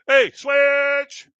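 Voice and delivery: mock shout. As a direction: bark it at the neighbours like a joke. Yelling at neighbours through wall.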